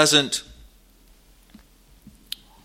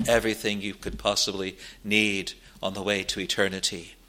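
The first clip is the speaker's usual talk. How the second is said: usual speaking voice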